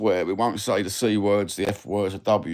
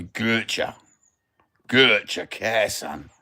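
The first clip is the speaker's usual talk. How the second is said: Cockney cheek, modelled for a pupil; Cockney catchphrase demo; much slower than usual. Shown as a demonstration. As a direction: teach "Gotcha" as Cockney slang, first syllable stretched. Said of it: "Shall we teach you some Cockney".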